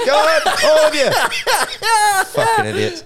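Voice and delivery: high, loud, laughing war-cry voice; rally the idiots like a cartoon officer over the top; laughing mock battlefield command; mock Somme officer. Imagining housemates as Somme soldiers.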